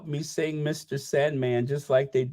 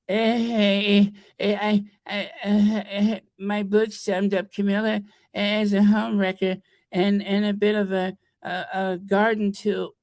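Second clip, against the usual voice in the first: mocking impression; 3 semitones higher than usual. Mocking the author's stammering complaint.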